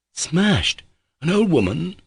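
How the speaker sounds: high, startled disbelief; shocked surprise. A narrator's reading. Pyotr Ilyich reacting to Mitya's confession, shocked. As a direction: gasp out the question, aghast.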